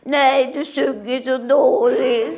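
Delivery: child mocking mother's singing; cheeky child. Acted child voice. (fräckt barn)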